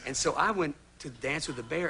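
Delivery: ordinary talk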